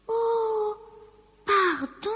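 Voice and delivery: high, hesitant apology; high-voiced Lucie, starting to apologise; apologetic. A narrator's reading. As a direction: begin a sorry in a high character voice. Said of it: "commença Lucie".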